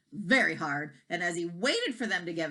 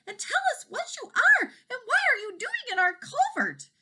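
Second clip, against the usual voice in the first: much higher cow's voice; 4 dB louder than usual. A narrator's reading. Cows waking the baby dragon, high voice. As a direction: pipe it like a curious cow.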